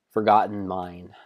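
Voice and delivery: plain reading voice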